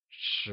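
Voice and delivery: Shi rising from mid pitch to high. Shown as a demonstration. Teacher modelling Shi with a mid-rising tone for learners. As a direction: lift Shi upward like a question. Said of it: "pronounced with a mid-rising tone".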